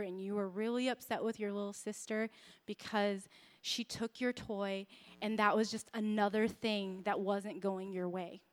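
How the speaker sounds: soft, low, tender reassurance; soothing mother. Retold or imitated. Mom to her crying daughter, softly soothing. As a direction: comfort an upset small child gently.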